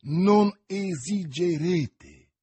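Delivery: very slow, each syllable spelled out in astonishment. A narrator's reading. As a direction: stretch out every syllable in disbelief. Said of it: "scandendo ogni sillaba di queste due parole".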